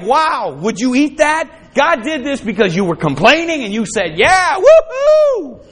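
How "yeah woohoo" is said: high, slow, stretched mock whoop; mock cheer. Preacher mocking the complainers' cheer, high mock whoop. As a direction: ham up a silly cheer.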